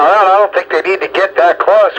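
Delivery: usual speaking voice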